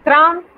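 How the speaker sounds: emphatic teacher model; stressed trente. Shown as a demonstration. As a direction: punch out trente for the class.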